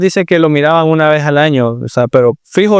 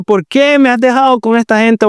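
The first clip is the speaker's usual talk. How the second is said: put-on complaining child; 7 semitones higher than usual. Child imagined crying.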